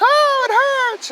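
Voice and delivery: put-on sudden squeaky high child cry, slowed; mock child wail; child noticing a cut. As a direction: play a kid suddenly seeing the cut. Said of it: "they're like".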